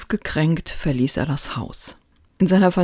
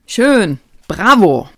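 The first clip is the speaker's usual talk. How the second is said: called out praise; 3 semitones higher, 8 dB louder, slower than usual. Acted. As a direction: shout the praise while exercising. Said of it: "rief Kehlmann".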